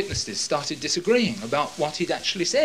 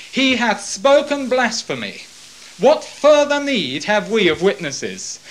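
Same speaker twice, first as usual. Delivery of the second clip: higher, loud, slow indignant cry; indignant outcry; 6 semitones higher, 6 dB louder than usual. Acted. High priest rending clothes before the court, loud and indignant. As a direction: cry out in outrage.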